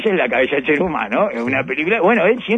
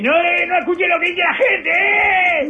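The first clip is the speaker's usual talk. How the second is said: hammed-up fan yelling at González; mock yelling; 12 semitones higher, much slower than usual. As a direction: shout support at him from afar. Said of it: "es gritarle al sordo González".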